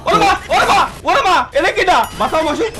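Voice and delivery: panicked high shout for help; cover me shout. Real, not acted. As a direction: yell for your teammate to save you. Teammate under fire, begging rescue.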